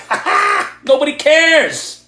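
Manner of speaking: high, sneering mock laugh then a slow brush-off; mocking dismissive laugh. Mocking the people who dismiss his excuses in a high sneer. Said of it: "They're like".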